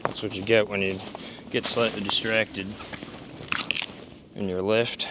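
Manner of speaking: ordinary talk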